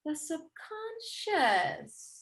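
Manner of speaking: slow, soft, drawn-out murmur; musing. Acted. Author pondering the director's word, slow and soft. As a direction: let it sink in, slowly and softly.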